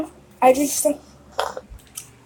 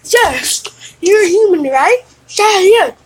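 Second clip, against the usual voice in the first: higher and louder, a chirpy little dinosaur; excited little creature nodding along; eager dinosaur voice; 8 semitones higher, 6 dB louder, slower than usual. Acted. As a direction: play the chubby dinosaur, bouncy and eager. Chubby dinosaur answers eagerly.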